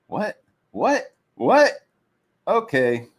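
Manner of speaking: stack the disbelief until it peaks; building disbelief. Retold or imitated. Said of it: "it's like".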